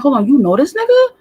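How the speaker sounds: high, loud incredulous question; outraged who-knew-him double take; shocked disbelief. Retold or imitated. Retelling confronting police, disbelief.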